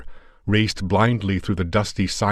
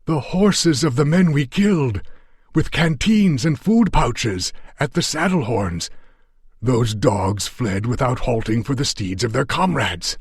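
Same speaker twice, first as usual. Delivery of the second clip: much higher, louder Afghan voice praising Allah; a battered fighter thrilled at rescue; excited Afghan; 6 semitones higher, 3 dB louder than usual. A narrator's reading. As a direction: Yar Ali shouting out the news of the horses. Yar Ali rejoices over horses.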